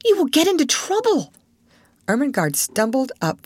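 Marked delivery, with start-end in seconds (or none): 0.0-1.3 higher, alarmed warning; a startled, worried warning; almost frightened. A narrator's reading. Sarah startled to find Ermengarde upstairs, alarmed. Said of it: "so startled that she was almost frightened".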